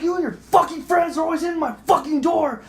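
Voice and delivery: high, accusing re-enacted yell; retold going off. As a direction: shout the accusation, fed up. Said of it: "I'm fucking going off".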